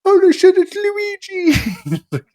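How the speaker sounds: squealing way up high and loud; mock kid shriek. Mimicking a kid shouting at the movie screen, squealing. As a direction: scream it like an overexcited child. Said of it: "some kid just".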